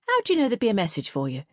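fast, suspicious demand. Acted. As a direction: challenge her, nosy. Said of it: "demanded Marjorie".

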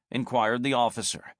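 normal talking voice

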